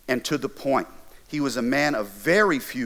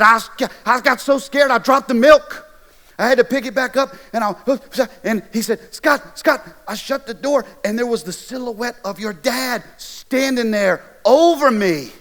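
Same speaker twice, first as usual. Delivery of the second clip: high, loud, panicked rush; scared retelling; 9 semitones higher, 6 dB louder than usual. Retold or imitated. Friend telling Scott how the dad scared him, high panicked voice. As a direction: relive the fright, voice up high and loud.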